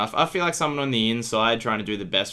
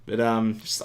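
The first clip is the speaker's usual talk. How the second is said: ordinary talk, nothing marked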